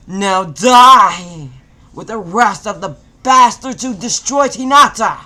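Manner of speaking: high, very loud raging scream. A narrator's reading. Naruto screaming at the killers, full rage. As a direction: scream the curse with everything. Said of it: "Naruto screamed".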